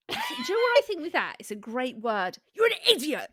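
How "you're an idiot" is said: sharp, aggressive insult. Shown as a demonstration. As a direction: spit it out aggressively. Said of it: "You can be quite aggressive with that".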